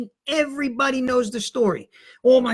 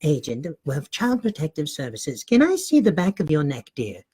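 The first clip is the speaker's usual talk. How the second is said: lower, imitating his mom as a caseworker; boy faking his mother's grown-up voice; 7 semitones lower than usual. Kid fakes mom's voice to caseworker. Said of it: "I was the one that talked like my mother".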